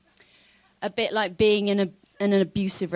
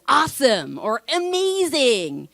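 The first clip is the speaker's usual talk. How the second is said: mock American enthusiasm; 5 semitones higher, slower than usual. Mimicking Americans' over-cheerful answers.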